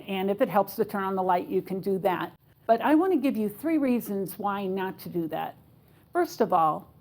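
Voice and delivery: normal talking voice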